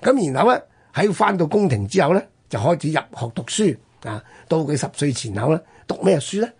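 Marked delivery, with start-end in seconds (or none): none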